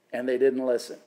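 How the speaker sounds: normal talking voice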